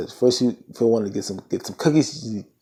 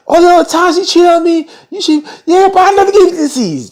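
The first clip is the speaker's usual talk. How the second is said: loud, very high falsetto outrage; mock indignant shriek; 7 dB louder than usual. Clarissa arguing about cheating and disease, mock shrieking. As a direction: shriek it like a wronged partner.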